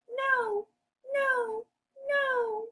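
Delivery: very high, squeaky little pig; high-pitched piggy voice. Acted. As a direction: squeal a refusal as a tiny pig. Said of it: "Each pig says".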